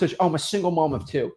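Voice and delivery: ordinary delivery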